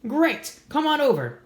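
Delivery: put-on eager little girl on a tin-can phone; excited invite. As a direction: bubbly kid calling through the can.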